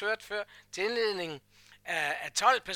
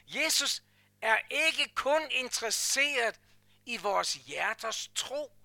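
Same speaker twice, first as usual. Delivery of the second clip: normal talking voice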